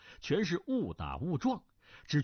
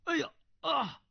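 high-pitched yelp of a staged fall; feigned loud cry; 14 semitones higher than usual. Acted. Cui faking a clumsy tumble before the prince, high yelp. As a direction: yelp high as if toppling over. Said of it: "大叫".

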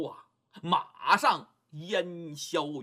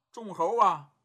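plain reading voice

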